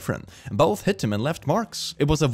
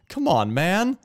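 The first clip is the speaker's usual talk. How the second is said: ordinary delivery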